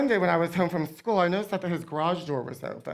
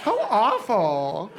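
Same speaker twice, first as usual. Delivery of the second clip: slow, put-on sympathy; feigned shock; slower than usual. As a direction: draw it out in mock horror. Feigning shock as the secret culprit.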